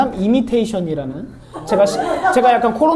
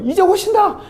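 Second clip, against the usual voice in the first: much higher, a thrilled cry of "he's coming"; excited cry; 8 semitones higher than usual. Retold or imitated. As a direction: shout it like the moment finally arrived. Believers at the stroke of midnight. (흥분해서 외치는 신도)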